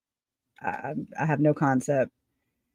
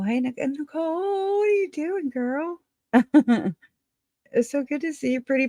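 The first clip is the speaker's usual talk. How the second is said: high, gushing hello to a friend; excited greeting; 8 semitones higher than usual. Real, not acted. Host greets friend Nicole in the chat, high and gushing. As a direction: squeal her name like you just spotted her.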